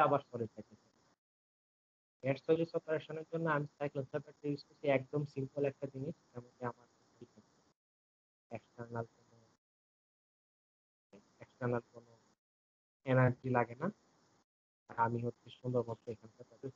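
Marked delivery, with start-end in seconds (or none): none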